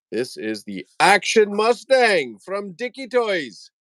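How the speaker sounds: high, slow, stretched-out reveal; showman's announcement. Pretend. Host unveils toy horse to viewers, showman's announcement. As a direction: sing out the product name like a showman.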